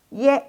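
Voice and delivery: Y as "yeh", held high; model ie. Shown as a demonstration. Teacher shows how i plus e reads, high held ye. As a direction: sustain Y as ye on a level pitch. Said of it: "Jadi bacanya".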